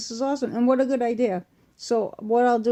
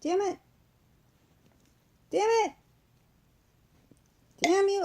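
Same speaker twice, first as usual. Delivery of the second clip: frustrated; 12 semitones higher than usual. Real, not acted. Struggling to open a tube.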